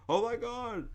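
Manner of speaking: very high, put-on weepy wail; mock crying. Comic crying at brother's wedding, mock crying. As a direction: squeal it as if in tears. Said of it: "I'm crying like".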